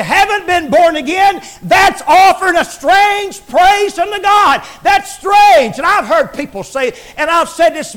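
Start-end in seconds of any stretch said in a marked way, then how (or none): 0.0-5.8 preacher's shouted warning; rising preach. Acted. Preaching at full force.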